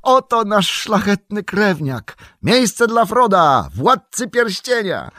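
high, loud, drawn-out proclamation; jubilant shouted announcement. A narrator's reading. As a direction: herald a hero's arrival with a shout. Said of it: "krzyknął zrywając się Pippin".

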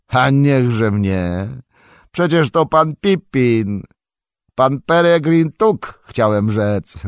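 put-on a farmer recognizing an old friend; surprised exclamation. A narrator's reading. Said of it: "zawołał i twarz rozchmurzyła mu się". (zdumiony, radosny okrzyk)

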